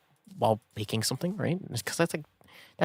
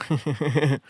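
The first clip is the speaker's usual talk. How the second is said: normal talking voice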